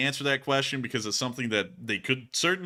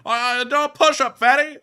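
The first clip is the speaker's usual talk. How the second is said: high shouted character voice; 12 semitones higher, 8 dB louder, slower than usual. Acted. Continues frantic character bit.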